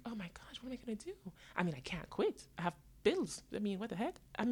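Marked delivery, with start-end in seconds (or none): 0.1-1.1 a hushed, breathless whisper of mock panic; panicked whisper. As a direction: whisper it as if afraid to say it aloud. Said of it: "And now I'm like".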